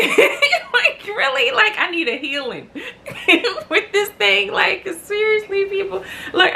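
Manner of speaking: high, laughing plea for help; laughing pleading to the viewers. Real, not acted. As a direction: laugh through it, half desperate. Laughing about needing help.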